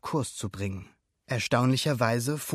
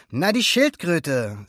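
drawn-out, slightly raised, matter-of-course reply; matter-of-fact; 4 semitones higher, 6 dB louder, slower than usual. A narrator's reading. Alberto answers Luca's question, matter-of-fact. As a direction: say it as if it were obvious. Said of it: "als wäre das völlig selbstverständlich".

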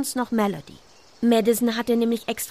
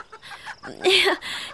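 put-on a bright girlish giggle; high happy girl's laugh; 10 semitones higher than usual. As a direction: giggle with delight. Madison giggles after hen.

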